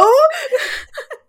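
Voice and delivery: high burst of laughter; real laughing fit; loud laugh. Real, not acted. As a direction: crack up loudly. Laughs after the no.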